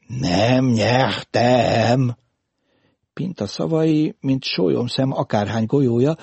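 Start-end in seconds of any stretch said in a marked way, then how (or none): 0.1-2.2 "Nem nyertem" very slow and drawn out, R burred; slow, loaded repeat with Pinta's R defect in "nyertem". A narrator's reading. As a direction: stretch every syllable of "nyertem", with a breathy H-like R. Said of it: "Most nem racsolt".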